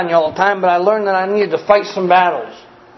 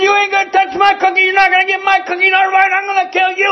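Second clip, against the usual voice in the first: put-on furious kid yell; 12 semitones higher than usual. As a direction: scream it like a tiny kid in a rage. Small boy swinging, yelling.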